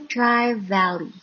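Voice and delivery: ordinary delivery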